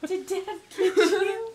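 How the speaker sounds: high teasing giggle; laughing. Real, not acted. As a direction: ask it while cracking up.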